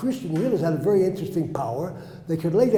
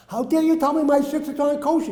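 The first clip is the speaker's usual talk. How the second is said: indignant; 9 semitones higher, 4 dB louder than usual. Retold or imitated. Indignant retort about kosher tzitzits.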